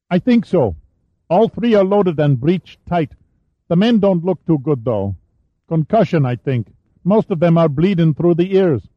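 put-on a teenage boy reporting up; adolescent voice. A narrator's reading. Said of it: "The voice had an adolescent pitch".

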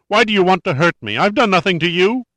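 high, frantic protest; high-pitched fearful pleading. Acted. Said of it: "His voice was high-pitched now".

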